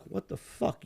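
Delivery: hushed, whispery aside; retold uneasy thought. Storyteller recalls watching trouble brew, hushed disbelief. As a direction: think it aloud, barely voiced.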